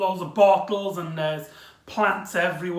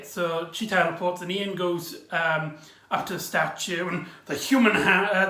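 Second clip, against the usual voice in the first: usual speaking voice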